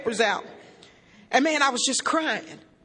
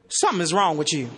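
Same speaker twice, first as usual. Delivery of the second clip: father's disapproval; 9 semitones lower than usual. Retold or imitated. Father's verdict on his friends.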